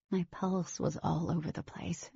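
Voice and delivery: usual speaking voice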